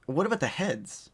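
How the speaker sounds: ordinary delivery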